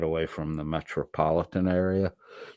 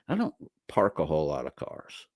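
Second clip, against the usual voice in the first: normal talking voice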